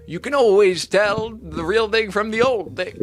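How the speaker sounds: put-on hesitant stab at an old Irishman's Irish accent; bad Irish accent. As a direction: try an Irish accent and half-fail. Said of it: "Let me try".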